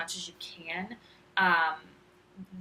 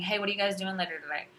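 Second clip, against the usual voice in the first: quick, run-together casual question; casual slangy speech; faster than usual. Shown as a demonstration. Teacher showing what not to say to students, quick casual speech. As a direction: toss it off fast, in everyday chat. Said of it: "instead of saying".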